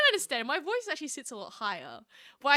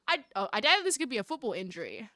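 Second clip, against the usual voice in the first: ordinary talk, nothing marked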